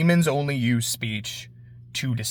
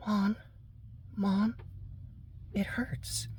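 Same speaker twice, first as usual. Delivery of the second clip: put-on a higher, faint little child's whimper; pleading child; 5 semitones higher, 16 dB quieter, slower than usual. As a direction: whimper it like a hurt little girl, fading to a breath. Cornered demon child pleads.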